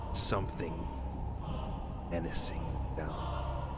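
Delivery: game master's ominous narration. Acted. Game master showing players the dark underworld, slow and ominous. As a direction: draw them down into dread, hushed then intensifying.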